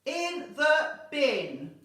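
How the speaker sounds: loud, slow, each word hammered; high, forceful pronouncement; ruthless headmistress. Acted. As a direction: declare it slowly at full volume. Headmistress answers Miranda.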